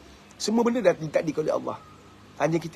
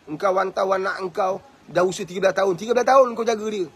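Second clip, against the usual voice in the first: normal talking voice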